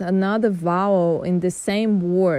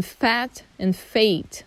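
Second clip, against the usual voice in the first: fat and fate said slowly; slow contrast of the vowels; slow minimal pair; slower than usual. Shown as a demonstration. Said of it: "The next one".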